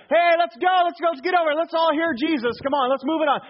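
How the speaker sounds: high, loud, eager shouting of 'let's go'; eager calling out. Pretend. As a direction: call the crowd forward like a cheerful usher. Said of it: "they should have been there kind of".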